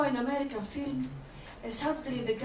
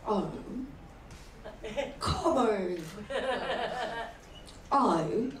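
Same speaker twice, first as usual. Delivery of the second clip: cold, halting British lover, lower pitch; British accent; 3 semitones lower, much slower than usual. Acted. Acting a cold British lover, reserved British accent. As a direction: deliver a mock British climax with long pauses. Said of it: "So British is like this".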